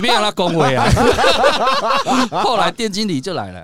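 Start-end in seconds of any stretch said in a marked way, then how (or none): 0.9-2.4 high, loud burst of laughter. Real, not acted. After telling the nightclub fight, loud laughter. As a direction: crack up at the memory.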